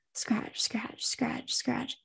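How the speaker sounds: soft whispered scratching. Acted. Unseen presence scratching at Casey, whispered. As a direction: breathe the scratches out quietly. Eerie scratching sound in dark room.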